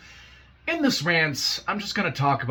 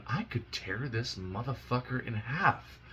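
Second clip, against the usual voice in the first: quiet menace; 8 dB quieter than usual. Real, not acted. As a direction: say it under your breath, slow and menacing. Quiet threat about the YouTuber.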